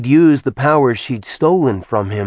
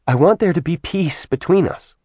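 higher, earnest plea for peace; pleading goddess; 8 semitones higher than usual. Acted. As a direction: ask for peace, leaning on want. Artemis voiced by narrator.

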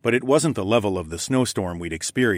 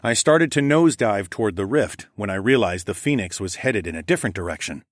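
plain reading voice

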